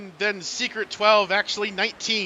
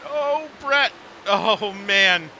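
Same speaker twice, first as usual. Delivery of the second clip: excited; 4 semitones higher, 5 dB louder, much slower than usual. Real, not acted. Crash happens live on stream.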